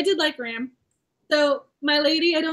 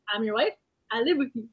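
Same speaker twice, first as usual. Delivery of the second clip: ordinary delivery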